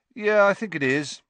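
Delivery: casual, offhand shrug of a line; off-hand. A narrator's reading. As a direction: toss it off like it's nothing. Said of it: "in an off-hand sort of way".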